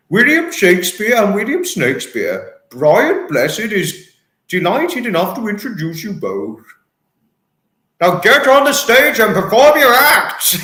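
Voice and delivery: high, grand stage introduction building to a bellow; Brian Blessed. Acted. Famous actor introducing two Williams onstage, booming. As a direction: present them theatrically, then roar the command. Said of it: "That would be Brian Blessed for oneself".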